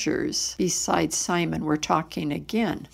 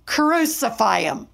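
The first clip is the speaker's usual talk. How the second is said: mildly raised, emphatic crowd demand; narrated crowd shout; 4 semitones higher, 4 dB louder than usual. A narrator's reading. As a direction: call it out like a storyteller voicing a mob. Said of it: "They shouted".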